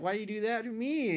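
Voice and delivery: high-pitched wounded complaint; reenacted hurt appeal; pleading protest. Reenacts protest to his teacher.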